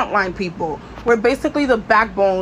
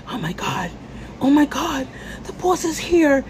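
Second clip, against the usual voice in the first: mock panic; 4 semitones higher than usual. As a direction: play a startled coworker, lightly. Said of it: "everyone is like".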